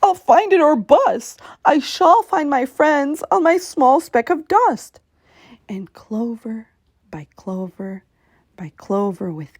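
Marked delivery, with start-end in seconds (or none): 0.0-5.0 louder, higher, resolute; determined cry. A narrator's reading. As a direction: Horton vowing to find his friends, crying out. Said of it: "cried Horton".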